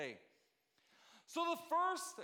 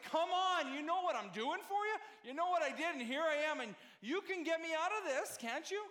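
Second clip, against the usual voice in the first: louder, higher pleading reproach; 6 semitones higher, 3 dB louder than usual. Retold or imitated. Husband imitating the tone his wife gives him, exasperated. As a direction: lay on the guilt, exasperated. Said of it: "my wife gives me this tone".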